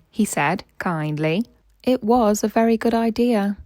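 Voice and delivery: put-on bright, lively read-aloud for a child; story narration. A narrator's reading. Parent reading a Thomas annual story aloud to a child, animated narration. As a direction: read it like a bedtime picture book.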